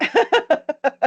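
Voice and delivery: a short high laugh; light laugh. Real, not acted. Host laughing at a fan's comment, light laugh.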